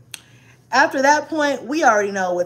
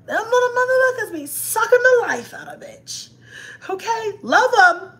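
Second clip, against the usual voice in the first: exasperated outburst; 12 semitones higher, 4 dB louder than usual. Real, not acted. Venting about kids.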